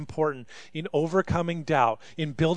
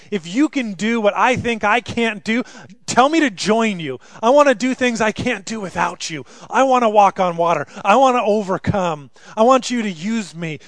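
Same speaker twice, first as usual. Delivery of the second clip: loud, higher, fervent plea to Jesus; Peter pleading; 4 semitones higher, 6 dB louder than usual. Acted. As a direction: cry out like Peter begging to be called. Preacher voices Peter's pleading prayer.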